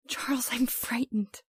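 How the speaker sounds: slow, scared whisper; frightened whisper. Acted. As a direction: breathe the words out in fear. Frightened wife in the dark.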